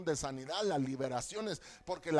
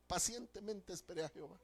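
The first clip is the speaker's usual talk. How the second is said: softer echo; 8 dB quieter, faster than usual. Acted. Preacher quotes Psalm 40 twice.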